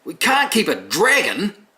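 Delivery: very high, loud, slow indignant squawk; grumpy broomstick voice. Acted. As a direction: protest like a shocked uptight broom. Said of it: "he says".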